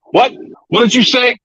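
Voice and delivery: very high, startled challenge; hammed-up high-pitched questioning; mock indignant demand. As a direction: burst in as an affronted boss, imitating. Retelling boss bursting in.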